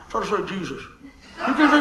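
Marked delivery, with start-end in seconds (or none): 0.6-0.8 "Jesus" squashed by a downturned mouth; mock frown on "Jesus". Shown as a demonstration. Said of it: "can't say with a frown".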